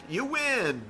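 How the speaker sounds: high, sing-song announcer call; light arcade-voice imitation; mock game announcer. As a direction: chirp it like an old arcade screen. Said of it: "usually you only got, like".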